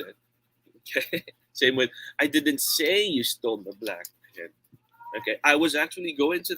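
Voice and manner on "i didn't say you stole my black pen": "say" lengthened and lifted in pitch; emphatic "say", the other words lighter; stress on SAY. Shown as a demonstration. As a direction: lean on the word "say". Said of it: "if we change word stress".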